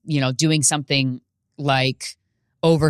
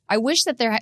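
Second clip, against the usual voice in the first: ordinary talk, nothing marked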